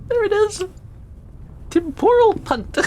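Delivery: high, gleeful spell announcement; triumphant spell call. Acted. Player casting temporal punt on Solomon, high and gleeful.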